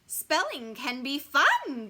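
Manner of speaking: drawn-out gleeful teacher; beaming. A narrator's reading. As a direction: sell it with a big smile. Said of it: "beams Missus Green".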